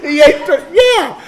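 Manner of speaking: high, loud cry straight out of laughter; amused outburst. Real, not acted. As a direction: blurt it out loud, cracking up. Laughing reaction to own punchline.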